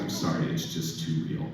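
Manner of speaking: quietly shaken, mildly tearful; understated upset actor; shaken apology. Retold or imitated. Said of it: "who's crying".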